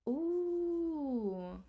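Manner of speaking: ordinary talk, nothing marked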